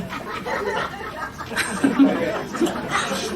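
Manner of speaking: a soft unvoiced laugh after the joke; breathy laugh. Real, not acted. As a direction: chuckle through the breath. Preacher laughs at own joke. (tertawa pelan)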